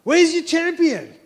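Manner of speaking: high, loud taunt to an enemy army; goading battlefield challenge; bellowed challenge. Acted. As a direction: call them out to fight, pitched well up. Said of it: "throwing out the challenge".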